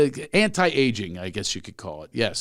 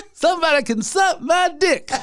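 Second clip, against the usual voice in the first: high-pitched, shouted comedian impression; loud, piercing stand-up punchline; Chris Rock; 5 dB louder than usual. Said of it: "I would tell it worse as him telling it".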